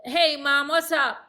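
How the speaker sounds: punchy, casual mock-son greeting; imitating her son. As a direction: hail mom like a breezy kid. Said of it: "he'll call and say".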